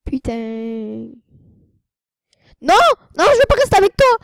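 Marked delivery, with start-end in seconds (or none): 2.6-4.2 fast, high yelling refusal; loud high shout. Acted. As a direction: yell it while running away. Fleeing a game character.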